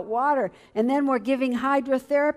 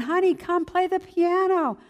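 put-on higher, coaxing old lady voice; an older church lady coaxes the young wife to play, higher and sweetly; 8 semitones higher than usual. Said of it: "She grabbed me by the hand".

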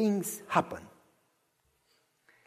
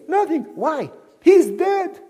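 put-on high, loud boyish protest; puzzled child; 8 semitones higher, 4 dB louder, slower than usual. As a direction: object like a confused little boy. Child at the grave.

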